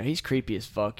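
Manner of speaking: ordinary talk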